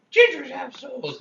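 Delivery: slow, high mock cry; drawn-out high whine; imitating ginger kid. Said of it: "the ginger kid that's like".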